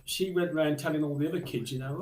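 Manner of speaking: ordinary talk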